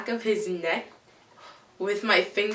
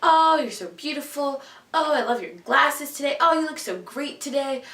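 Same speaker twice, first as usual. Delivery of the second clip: mock sweet compliments, higher voice; gushing sweet talk; 5 semitones higher than usual. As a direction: gush like a flattering crush. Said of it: "say these really nice things".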